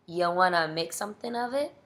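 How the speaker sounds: plain reading voice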